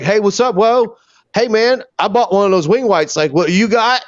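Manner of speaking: high, eager mock voice of an excited caller; imitating Vape. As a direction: play the overexcited guy on a video call. Retelling Vape's excited video call.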